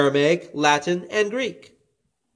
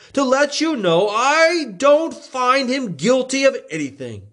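a lightly raised governor's voice; Pilate voiced; 10 semitones higher than usual. A narrator's reading. Pilate to the crowd, lightly voiced and higher. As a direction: Pilate announcing, a bit higher.

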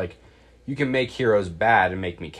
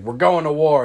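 gung-ho Superman; 6 semitones higher, 8 dB louder than usual. Retold or imitated. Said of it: "And Superman was like".

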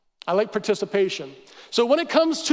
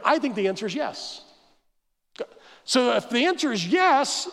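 normal talking voice

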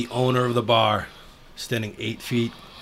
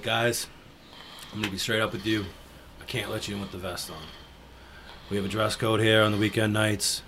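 much softer, careful and hushed; nervous quiet; 4 semitones lower, 7 dB quieter than usual. Retold or imitated. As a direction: say it softly, afraid to provoke. Telling Hell's Angel no entry.

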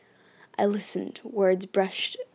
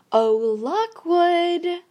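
high, slow sing-song call, louder; merry sing-song; 9 semitones higher, 9 dB louder, much slower than usual. A narrator's reading. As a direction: coo it like to a cuddly pet. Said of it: "I was using a merry sing-song int".

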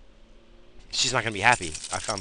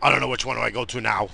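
mock panic; 3 semitones higher, 8 dB louder than usual. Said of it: "now I'm like".

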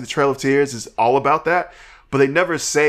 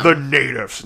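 conservative voice; slower than usual. Pretend. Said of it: "I said that in a conservative voice".

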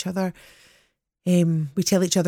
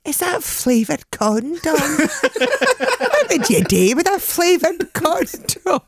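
put-on old lady voice; 7 semitones higher, 3 dB louder, slower than usual. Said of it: "And I said to her".

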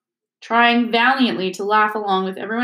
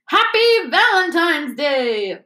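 high, slow, beaming hammed-up teacher voice; Lockhart's cheery shout; 7 semitones higher, slower than usual. A narrator's reading. Lockhart greets the Great Hall, hammed-up and high. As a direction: announce it grandly and slowly. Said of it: "Lockhart shouted".

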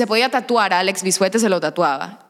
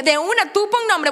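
eager acceptance; 11 semitones higher than usual. Retold or imitated. Acts out instant acceptance.

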